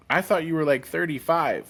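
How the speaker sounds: high, lightly imitated wonder; mock amazement. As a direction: retell people's surprised guess, a bit hammed. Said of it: "They're like".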